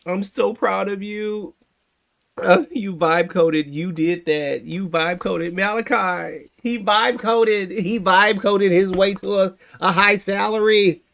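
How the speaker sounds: loud, high sarcastic cheering with laughs; sarcastic hammed-up praise, loud and high; mock celebration. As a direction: overblown fake joy for the vibe coder. Mock celebration of vibe coder.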